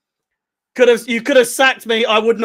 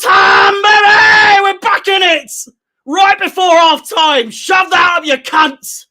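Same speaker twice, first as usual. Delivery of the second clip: high, loud, drawn-out gloating cheer; triumphant shouting; 9 semitones higher, 5 dB louder than usual. Real, not acted. Fan roaring that they are back before half time, elated shouting. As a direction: crow it out with stretched words. Fan still celebrating the goal.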